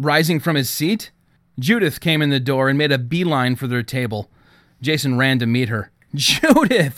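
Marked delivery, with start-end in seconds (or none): 6.1-7.0 a high, loud call of her name; shout. A narrator's reading. Jason greeting Judith across the rink, a happy shout. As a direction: call her over with delight. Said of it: "he shouted".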